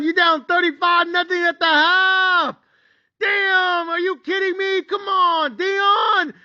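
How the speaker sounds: high, exasperated pleading shouts; upset fan yelling. Retold or imitated. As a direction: beg your hopeless team, then curse. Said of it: "I'm upset".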